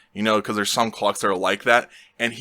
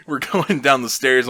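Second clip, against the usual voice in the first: ordinary delivery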